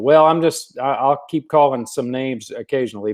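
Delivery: normal talking voice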